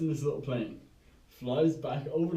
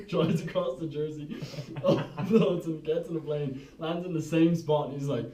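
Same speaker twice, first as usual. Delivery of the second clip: ordinary talk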